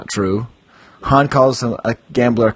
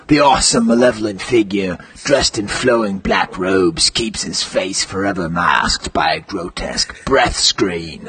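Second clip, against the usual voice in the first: dramatic reading; 4 semitones lower than usual. Acted. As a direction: intone it like a movie trailer.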